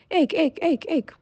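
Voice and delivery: high, sharp frog croaks; croaking frogs. A narrator's reading. Frogs crying at the peasant from the pond, high croaks. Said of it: "but still cried".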